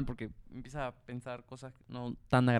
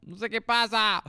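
real yelling; 8 semitones higher, 3 dB louder than usual. Real, not acted. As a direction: shout so the mic catches you. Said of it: "Tengo que gritar".

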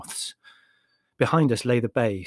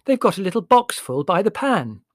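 higher, louder and very distinct; clear, penetrating voice; 8 semitones higher, 6 dB louder than usual. A narrator's reading. Margot chides Leslie at lunch in a penetrating voice. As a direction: cut across the table clearly. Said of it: "in a clear and penetrating voice".

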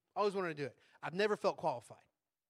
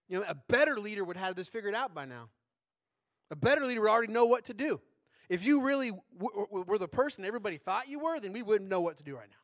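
louder, scolding inner critic voice; harsh inner critic; 3 semitones higher, 8 dB louder than usual. Retold or imitated. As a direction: berate yourself like a harsh critic. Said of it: "there's this critic in my head".

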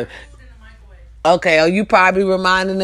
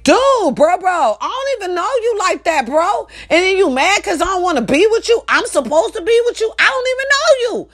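shocked outburst; 10 semitones higher than usual. Retold or imitated. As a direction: yell in disbelief at a stranger. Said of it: "'cause I'm like".

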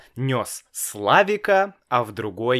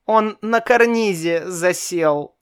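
put-on small boy; 8 semitones higher, 3 dB louder than usual. A narrator's reading. Child answers.

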